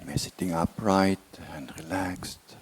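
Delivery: slow, measured meditation guidance with long silences; unhurried body-scan voice, words drawn out; guided meditation. Real, not acted. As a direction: ease them slowly into relaxation, phrase by phrase. Leads a body relaxation meditation.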